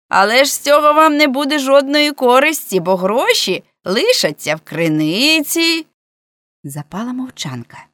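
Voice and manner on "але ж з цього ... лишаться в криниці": loud call, high at first then settling; ringing voice. A narrator's reading. Man below reasoning with his threateners, loud high call. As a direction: argue it up from the well. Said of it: "лунав голос Гієрмо".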